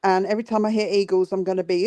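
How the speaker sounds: ordinary delivery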